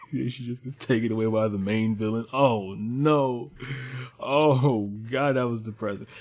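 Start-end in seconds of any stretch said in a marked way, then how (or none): none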